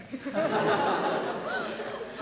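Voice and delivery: high breathless laugh, almost silent; airy laugh. Real, not acted. Laughing at the boy's gruesome joke, high and airy. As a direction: break into a high wheezy laugh.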